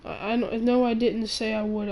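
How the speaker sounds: ordinary talk, nothing marked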